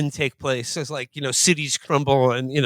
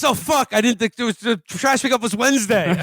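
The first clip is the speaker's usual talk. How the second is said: mock panic; 6 semitones higher than usual. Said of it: "And he goes".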